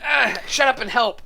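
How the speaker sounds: exasperated shout. Acted. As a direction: snap it out under strain. Player yells at companions while shoving bookshelf.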